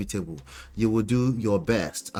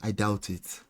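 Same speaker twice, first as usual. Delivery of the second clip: soft, low and doubtful; quiet doubt; 3 semitones lower, 7 dB quieter than usual. Real, not acted. Sceptical remark, then repeated whispered.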